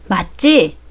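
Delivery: normal talking voice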